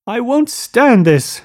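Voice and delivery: very high, slow, loud cry; distressed cry. A narrator's reading. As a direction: cry out, unable to bear it. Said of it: "cried Daisy".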